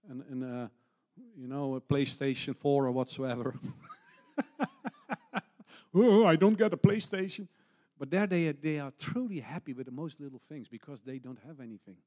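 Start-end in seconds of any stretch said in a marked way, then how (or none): 4.4-5.9 mocking laugh. Mocking spoiled teenagers. 5.9-7.5 high, loud mock whine of a spoiled teen; whiny teenager. Preacher mocks spoiled teenager denied PlayStation, whiny and loud.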